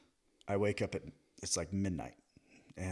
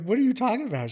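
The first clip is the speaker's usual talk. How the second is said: high, louder incredulous comeback; exasperated retort; 14 semitones higher, 6 dB louder, faster than usual. Retold or imitated. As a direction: act baffled and a bit indignant. Quoting his retort to the boss.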